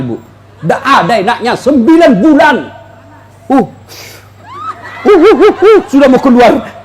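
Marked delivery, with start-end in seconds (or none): 3.5-6.8 mock pregnant groaning. Imitating pregnant woman groaning.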